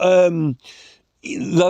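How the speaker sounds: ordinary talk, nothing marked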